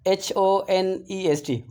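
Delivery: slow letter-by-letter spelling; teacher spelling out a word; spelled out slowly. Shown as a demonstration. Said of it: "इसमें honest".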